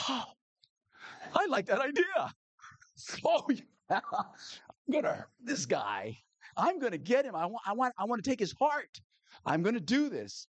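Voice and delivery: gleeful scheming wife. Acted. Wife delights in the lawyer's revenge plan, high and gleeful. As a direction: savour the plan with high giddy glee.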